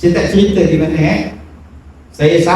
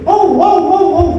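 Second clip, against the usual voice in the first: ladridos agudos imitados; dogs barking; 13 semitones higher, slower than usual. Retold or imitated. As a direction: imitar a una jauría de perros. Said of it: "Anjing empat lima ekor".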